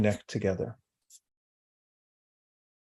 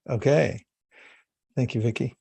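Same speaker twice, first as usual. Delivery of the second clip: ordinary talk, nothing marked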